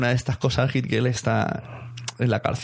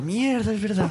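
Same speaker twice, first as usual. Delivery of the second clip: dismayed realization; 9 semitones higher, slower than usual. Retold or imitated. Imagined person realizing mistake.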